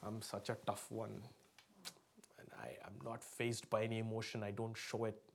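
low, soft imitation of a stoic man; tough man. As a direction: play the unfazed tough guy, quiet and low. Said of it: "some men are like".

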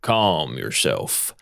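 low, slow Southern drawl. Acted. Hunter soothes his gecko horse, slow low Southern drawl. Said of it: "I'm gonna have to do the southern drawl".

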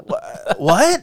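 high, startled double what; baffled what. Retold or imitated. Friends mocking a movie line, baffled high what. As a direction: squeak it out, stunned. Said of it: "You're like".

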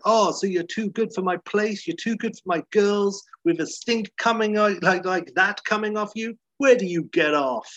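a hint of an insulted sleazy owner; mock offended pimp. Motel owner taking offence at Larry, mildly mock-voiced. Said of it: "the owner's like".

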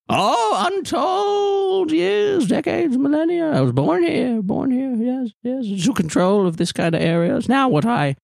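very high, drawn-out reminiscing voice; eccentric ancient hostess. Acted. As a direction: reminisce grandly, high and odd.

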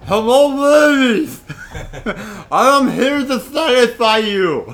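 slow, loud, thick-tongued ladies' man; big-tongued voice. Pretend. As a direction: talk like your tongue is too big. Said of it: "he can't talk".

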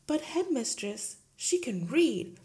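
lightly voiced gentle teacher; a hint of the meek young teacher; Miss Honey pleading. Teacher protesting to her headmistress, lightly voiced.

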